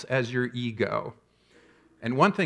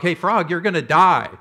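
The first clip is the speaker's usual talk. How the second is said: loud mock warning shout; yelling at the frog; 4 semitones higher, 6 dB louder than usual. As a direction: yell it at the frog like a scolding therapist. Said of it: "yell at this frog".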